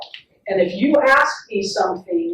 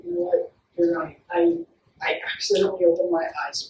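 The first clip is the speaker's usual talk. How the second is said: soft, low admission; quiet acted confession to a child; repairing apology; 4 semitones lower, 6 dB quieter, faster than usual. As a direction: own up gently, voice dropped. Acting her apology to the child.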